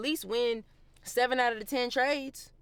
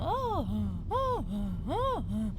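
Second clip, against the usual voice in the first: up-and-down wail; much slower than usual. Retold or imitated. Not wanting to be winning up and down, swooping "oh"s. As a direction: mimic a roller coaster with your voice. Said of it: "winning up and down".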